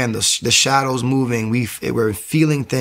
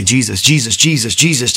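louder, insistent repetition of the name; repeated invocation; 5 dB louder than usual. Retold or imitated. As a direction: re-enact calling on Jesus over and over. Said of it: "I would walk around and say".

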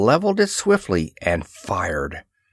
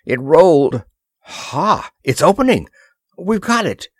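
higher, rising burst of excitement; excited triumph; 6 semitones higher, 4 dB louder than usual. A narrator's reading. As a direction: exclaim it as the escape starts working. Prisoners get the door open.

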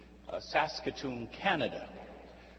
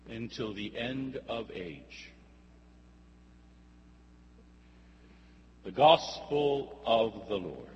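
gospel reading. Acted. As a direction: proclaim it to the congregation. Priest proclaims the Gospel reading.

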